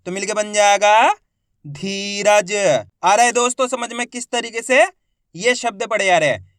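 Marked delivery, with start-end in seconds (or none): 1.7-2.5 धीरज sounded out in long syllables; drawn-out long ई. Shown as a demonstration. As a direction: stretch धी in धीरज. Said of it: "खींच के पढ़ा जाता है".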